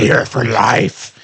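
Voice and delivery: slow, eerie demon voice; possessed voice. Pretend. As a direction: drawl it out like a possessed man. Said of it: "read it in your best possessed voice".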